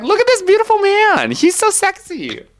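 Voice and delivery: high, swooning gush over the poster. Real, not acted. Fan showing off a Hades poster to listeners, swooning gush. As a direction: swoon over him like a fangirl.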